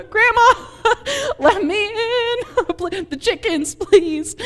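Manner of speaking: put-on a child pleading at the door, scared and laughing; panicked pleading. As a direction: beg through half laughs, half tears. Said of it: "and I was like".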